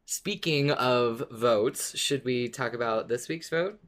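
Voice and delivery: ordinary talk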